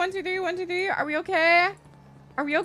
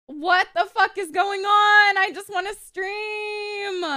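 exasperated wail, last word drawn out; real streamer frustration, stretched ending; frustrated whine; 3 semitones higher than usual. Real, not acted. Stream keeps breaking, she complains.

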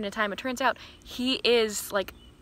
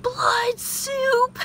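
high, loud mock shriek of "Blood soup!"; mock scream; 7 dB louder than usual. Hero shrieking at tomato soup, hammed-up high shriek. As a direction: shriek it like a terrified Viking. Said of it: "he, like, screams".

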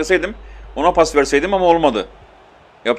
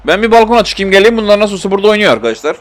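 higher, louder and fast, dripping sarcasm; sarcastic aside; 6 semitones higher, 5 dB louder, faster than usual. Real, not acted. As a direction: toss it off like a fed-up joke. Sarcastic aside while game stalls.

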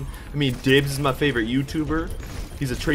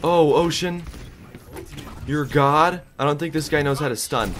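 normal talking voice

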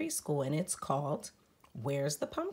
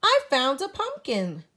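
higher and louder, thrilled; excited; 9 semitones higher, 8 dB louder than usual. A narrator's reading. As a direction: burst out with the find. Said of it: "Spook says excitedly".

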